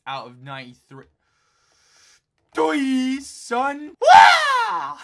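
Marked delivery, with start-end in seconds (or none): 2.5-5.0 loud, higher whoop that breaks into a laugh; triumphant yell. Real, not acted. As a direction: crow in victory then cackle. Triumphant outburst while gaming.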